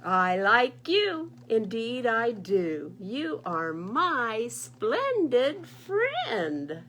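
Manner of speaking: loud, very slow heartfelt goose reading; tender note. Acted. Goose reading his note to bear, slow tender loud. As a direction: savour each word with love.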